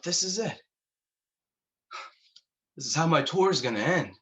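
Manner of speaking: high-pitched, mild panic; acted dread, pitch jumping up; alarmed inner thought. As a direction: think it aloud as if doomed. Acted thought, fearing he'll be killed.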